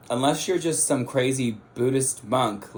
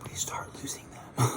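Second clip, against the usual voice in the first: breathy whisper, almost silent; 8 dB quieter, faster than usual. Real, not acted. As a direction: whisper it like a fear. Whispered fear of losing people.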